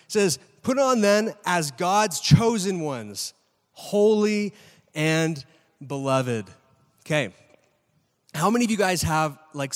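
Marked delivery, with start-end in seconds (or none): 0.6-6.5 slow scripture reading. Acted. Preacher reading scripture aloud.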